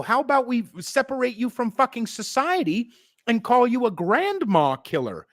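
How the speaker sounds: hammed-up mock bribe pitch; mocking sarcasm. Mocking the state's offers.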